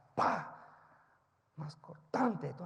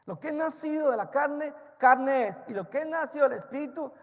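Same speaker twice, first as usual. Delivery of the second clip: louder, higher emphatic sermon line; loud preaching; 10 semitones higher, 11 dB louder than usual. Acted. Preacher proclaiming to the congregation, loud. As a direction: proclaim it to the church.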